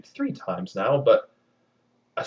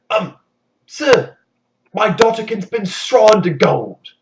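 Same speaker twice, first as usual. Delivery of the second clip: nervous blurt; 9 semitones higher, 10 dB louder, slower than usual. Acted. Miller blurting to the king.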